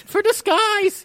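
very high, drawn-out excuse; defensive squeak. Pretend. Player explains wearing a plant, high defensive voice. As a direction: stretch it out high, pleading your case.

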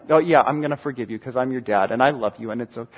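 low, soft, quick murmur; reassuring dad. Acted. Dad forgiving his child, reassuring. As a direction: reassure quietly in one weary-free run.

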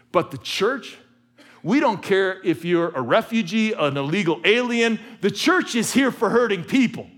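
rising pulpit fervor; preaching at full force. Acted. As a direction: preach it out to the back row. Preaching climax on the church.

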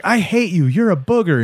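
high, mock-angry kid sister; imitated angry sister. Sister to brother as she runs off, high mock anger. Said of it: "she's like".

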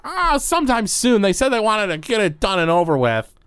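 very high, goofy character voice; Gorbo Borgo. Acted. Game master plays Gorbo Borgo.

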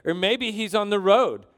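lively, acted mockery; mocking taunt. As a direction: taunt them with exaggerated helpful suggestions. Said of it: "Elijah mocked them".

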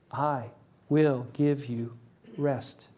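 slow, soft; quiet, slow, tender quote; tender promise. Acted. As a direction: offer rest gently.

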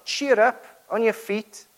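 high, loud, eager encouragement; eager call. Retold or imitated. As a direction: call him up excitedly. Crowd calls to the blind man.

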